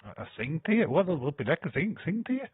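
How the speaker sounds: "sing tear" rising up high, baffled; puzzled misreading. Shown as a demonstration. Re-reading the chat's "sing tear" in disbelief, rising. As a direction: question the word "sing" with a climbing tune. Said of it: "send a single tear".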